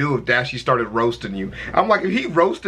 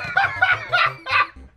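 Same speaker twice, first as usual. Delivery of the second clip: stifled giggles. Real, not acted. Laughing at friend's fail.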